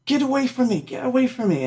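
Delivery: high-pitched mock cry of get away; mock protest. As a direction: squeal it high like a man fleeing a recorder. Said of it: "and he's like".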